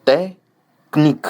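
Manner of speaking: slow, loud, high "technique" as "té... knique"; pause, then run k and n together in "technique"; pause before consonants. Shown as a demonstration. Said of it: "mettre la pause avant ou après". (« technique » fort, avec une pause avant « kn »)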